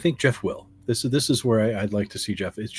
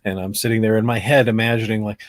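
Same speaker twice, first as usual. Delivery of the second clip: ordinary delivery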